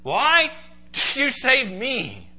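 bewildered, impassioned plea; astonished question. Acted. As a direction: cry out "Why" high, then stretch "save me". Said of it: "You ever asked yourself that question?".

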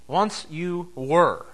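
Once you were, each word drawn out slowly; slow emphatic repeat. Shown as a demonstration. Preacher reminding the congregation, slowly and weightily. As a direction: let Once sink in, said slowly.

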